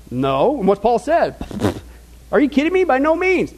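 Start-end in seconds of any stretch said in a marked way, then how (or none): 2.3-3.5 pitched high, incredulous scoff; sarcastic disbelief. Retold or imitated. Paul scoffing at Christendom with sarcastic disbelief. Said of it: "You can hear Paul's sarcasm".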